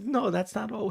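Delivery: high-pitched Italian wife; comic high old-lady voice; old Italian woman. Acted. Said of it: "she brings the old Italian woman in".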